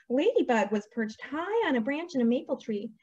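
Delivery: lively, sing-song storybook delivery; animated picture-book narration; story reading to children. A narrator's reading. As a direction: read it to little ones with big pitch swings.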